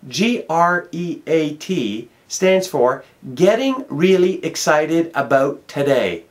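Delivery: letters of great spelled slowly and deliberately. Shown as a demonstration. Explaining the meaning of great to the man, slow spelling. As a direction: lay out each letter like a lesson. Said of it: "let me explain to you what great stands for".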